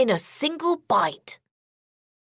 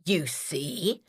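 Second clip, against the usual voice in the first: lower, softer sly wolf; cunning wolf leaning in, lower and softer; 8 semitones lower, 7 dB quieter than usual. A narrator's reading. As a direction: say it slyly like a scheming wolf. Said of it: "said the cunning wolf".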